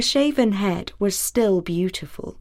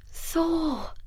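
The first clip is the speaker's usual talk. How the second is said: faint voice; 7 semitones higher, 8 dB quieter than usual. Acted. Sif speaks from the shadows.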